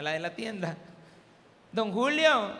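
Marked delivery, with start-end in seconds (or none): 1.7-2.5 loud high call of a name. Retold or imitated. Shopkeeper neighbor hails him from the store, loud call. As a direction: hail him across the street.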